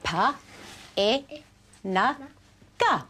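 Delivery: "pa, e, na, ga" as separate sounds; sounded out. Shown as a demonstration. Teacher breaking ping into sounds, "pa, e, na, ga" said separately. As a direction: say "pa, e, na, ga" singly, pausing between. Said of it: "we've got pa".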